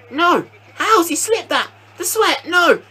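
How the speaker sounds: high-pitched disbelieving cries; panicked dismay. Real, not acted. Gamer seeing the opponent slip through, high panicked cries. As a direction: cry out as it goes wrong. Conceding a goal.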